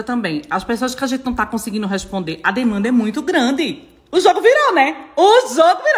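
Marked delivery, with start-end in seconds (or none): 4.1-6.0 higher, louder triumphant quip; gleeful. Real, not acted. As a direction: crow that the tables turned. Gleeful remark to followers. (o jogo virou em tom triunfante e animado)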